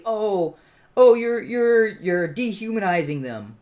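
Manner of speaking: high, loud mocking whine of an offended opponent; mock outrage. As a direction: sneer it like a shocked accuser.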